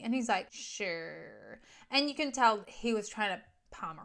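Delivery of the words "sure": low, soft, drawn-out brush-off; noncommittal agreement. Retold or imitated. Man palming off a woman who asks for calls, noncommittal. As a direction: trail it out low, palming her off. Voicing reluctant man's brush-off.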